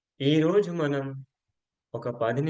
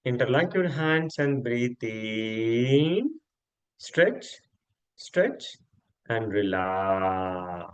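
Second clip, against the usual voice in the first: guided breathing cues; much slower than usual. Acted. Yoga teacher leads breathing in, stretching and relaxing, guided and drawn out. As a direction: draw out the words to pace breath and body.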